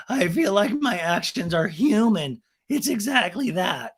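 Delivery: high, slow mocking imitation; Raquel. Recapper mimicking Raquel defending herself, high and slow. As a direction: mimic her earnest, drawn-out defence. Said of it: "Raquel's like".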